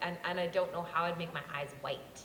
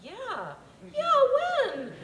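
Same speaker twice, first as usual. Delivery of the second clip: retold eager agreement; 5 dB louder, slower than usual. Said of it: "I was like".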